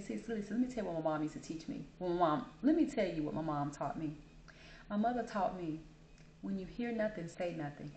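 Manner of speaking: hushed motherly wisdom; retold leaning-in advice. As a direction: lean in and counsel her quietly. Leaning in to advise cashier.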